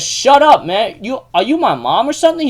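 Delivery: high-pitched, exasperated mock comeback; acted irritated outburst. Manabe tells Yuki to shut up, acted irritation. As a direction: burst out high, as if fed up. Retold quarrel, telling off.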